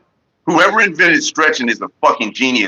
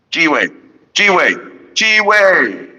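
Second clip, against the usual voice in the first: each one higher and higher; rising hype call; 7 semitones higher, much slower than usual. Real, not acted. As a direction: build the hype, pitching up.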